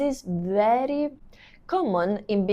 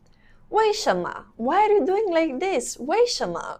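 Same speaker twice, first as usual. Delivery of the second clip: higher-pitched acted demand for a reason; acted questioning; 8 semitones higher than usual. Teacher acting out asking someone why, higher questioning.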